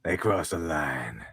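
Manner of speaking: deep, slow, soft trailer voice; slow, low movie-trailer narrator. Pretend. Repeats tagline dramatically.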